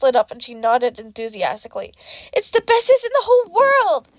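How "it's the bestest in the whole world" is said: put-on high, loud little girl gushing; excited child yell. A narrator's reading. Little girl telling the hero her favourite flavour, high and loud. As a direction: shout it like a thrilled six-year-old. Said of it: "she yelled".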